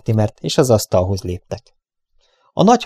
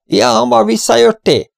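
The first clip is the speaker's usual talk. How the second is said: much higher, carrying question; calling out; 12 semitones higher, 3 dB louder than usual. Acted. Lou calling to the newcomer, raised surprised call. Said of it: "hallatszott Lou kiáltása".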